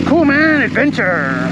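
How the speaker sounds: a quick, high burst of delight; excited cheer. Real, not acted. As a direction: cheer it out, pleased with the trail. Thrilled exclamation on the trail.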